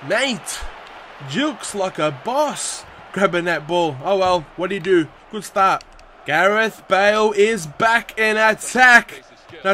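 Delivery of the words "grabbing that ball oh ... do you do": ordinary delivery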